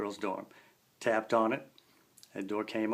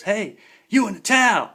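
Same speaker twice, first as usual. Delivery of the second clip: loud, high yell across a distance; retold guard's yell, loud and high; calling out; 10 semitones higher, 12 dB louder than usual. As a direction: shout to stop him. Guard yelling across campus.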